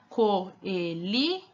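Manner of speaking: coeli in separate beats, co, e, li; sounded out. Shown as a demonstration. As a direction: read coeli slowly as an unfinished word, syllable by syllable. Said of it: "se você ler aqui a palavra". (coeli separado em co, e, li)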